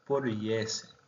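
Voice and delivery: ordinary talk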